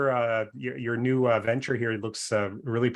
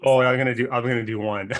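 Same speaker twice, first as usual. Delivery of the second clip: ordinary talk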